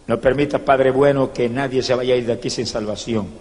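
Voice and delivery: low, soft, hushed prayer. Real, not acted. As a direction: pray quietly and humbly. Prayer after sermon. (oración baja y suave)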